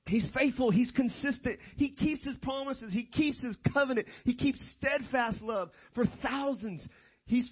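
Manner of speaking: high, rhythmic pulpit declaration; emphatic preaching. Real, not acted. Preacher lists God's faithfulness to congregation, emphatic high preaching.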